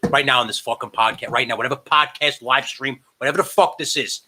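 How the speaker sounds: ordinary talk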